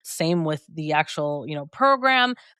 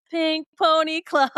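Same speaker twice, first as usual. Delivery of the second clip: mock starstruck gasp, pitched much higher and slow; 9 semitones higher than usual. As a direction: gush like a starstruck fan. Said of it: "I'm over here starstruck".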